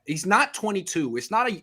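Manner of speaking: ordinary talk, nothing marked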